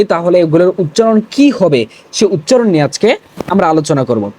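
ordinary delivery

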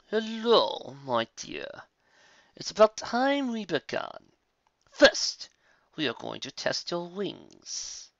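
high, slow, silky villain voice; sinister villain. Acted. Bloodwing greets the captive filly, slow and sinister. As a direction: savour every word like a predator.

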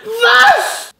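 high startled shout. Acted. Sudden shout.

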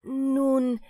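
plain reading voice

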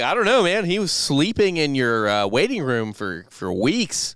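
mock accuser. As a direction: press him like an indignant witness. People accusing Arthur.

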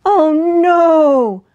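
high, loud, drawn-out wail; long sheep cry of alarm; bleating sheep. A narrator's reading. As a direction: bleat it in dismay. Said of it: "bleated the sheep".

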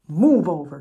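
whispered growl; a hushed bear growl. Acted. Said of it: "growled Bear".

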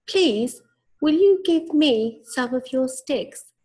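high squeaky pig voice; little pig voice. A narrator's reading. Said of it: "asked the second little pig".

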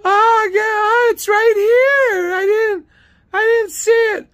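piercingly high, loud, drawn-out mockery; hammed-up falsetto caricature; Dee's high-pitched voice. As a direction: wail it like a squeaky clerk caught out. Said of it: "She has this high-pitched voice".

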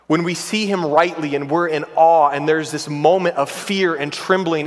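usual speaking voice

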